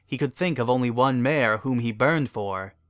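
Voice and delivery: ordinary delivery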